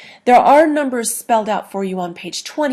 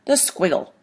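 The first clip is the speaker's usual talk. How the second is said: ordinary talk, nothing marked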